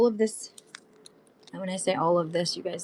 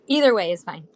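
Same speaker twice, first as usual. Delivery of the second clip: ordinary talk, nothing marked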